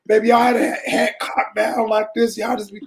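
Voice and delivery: normal talking voice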